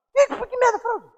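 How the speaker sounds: pitched high in outraged disbelief; indignant disbelief. Acted. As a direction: scoff at the very idea. Mocks the idea of fraud. (descrença indignada, voz bem aguda)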